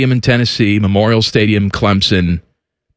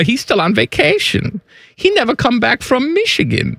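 put-on slipping German accent; 9 semitones higher than usual. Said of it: "That didn't quite sound German".

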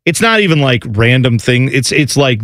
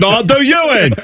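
higher mock British threat; English hooligan comeback; 10 semitones higher than usual. Rival hooligan firing back in a mock British accent. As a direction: top his threat in a silly English accent.

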